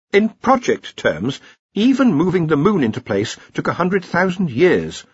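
high-voiced academic correcting his seniors; Ponder, earnest junior wizard. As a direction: explain patiently in a high voice. Ponder explaining project time.

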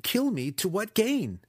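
high, desperate question; crying out. A narrator's reading. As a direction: plead in alarm for a reason. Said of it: "cried Alton".

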